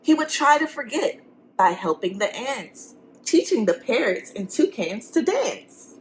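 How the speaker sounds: lively, sing-song storybook reading; reading to children. A narrator's reading. Teacher reading a picture book to the class. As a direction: read it to the kids with rhyme.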